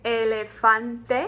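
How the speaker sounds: elefante slow, every E clear, final E sounded; careful Spanish. Shown as a demonstration. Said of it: "as in the word".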